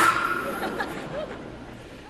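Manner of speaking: breathy high-pitched laugh; real amused laugh. Real, not acted. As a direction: burst out laughing. Laugh at own story.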